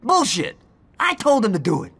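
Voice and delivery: high, loud denial; angry shout. Acted. Johnny shouting down Aisha's claim, furious. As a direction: snap back hard. Continuing the quarrel.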